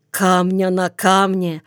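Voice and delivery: ordinary delivery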